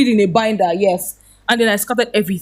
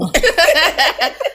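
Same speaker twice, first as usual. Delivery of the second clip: laughter; 8 semitones higher than usual. Real, not acted. Laughing at scattered papers story.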